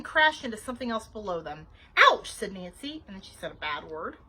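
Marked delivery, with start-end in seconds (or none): 2.0-2.3 high, loud yelp; pained yelp. A narrator's reading. Babysitter bumping into something, yelping. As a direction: cry out at a sudden knock.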